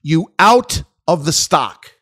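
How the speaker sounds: slow, loud, high mocking order; imitating the CEO. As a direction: hammer the CEO's demand out slowly. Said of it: "he says".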